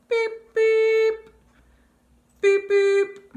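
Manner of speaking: high, loud playful beeping; car horn beeps. Acted. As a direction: honk it like a toy car horn. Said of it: "The car says".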